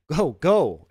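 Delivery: urgent loud cry of go go; exclaiming. Acted. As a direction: push them away, loud and urgent. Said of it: "exclaimed Dantes".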